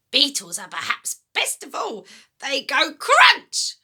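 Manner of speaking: put-on a greedy, gloating old woman's voice; wicked old grandma. Grandma taunting George about eating slugs, gloating. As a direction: savour every disgusting word.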